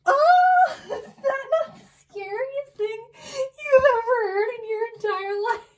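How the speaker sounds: high, loud laughing exclaim; laughing shout. Real, not acted. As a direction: shriek it out through laughter. Laughing at the scary story.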